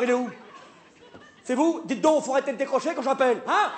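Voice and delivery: ordinary talk, nothing marked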